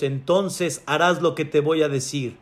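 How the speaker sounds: softly spoken, calm authority; God's calm voice. Acted. God prepares the climber for an order, quietly. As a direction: instruct quietly and evenly.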